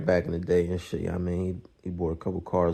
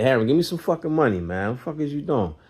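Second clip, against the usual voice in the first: higher, fast heated demand; mock demand; 6 semitones higher, 3 dB louder than usual. Narrator demands money from Hammer, fast heated demand. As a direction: push him for the money.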